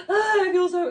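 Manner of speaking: high, loud fake crying outburst; mock guilty wail. Mocking her crying from guilt over Ariana, mock wail. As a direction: burst into a hammed-up sob. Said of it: "You feel so guilty".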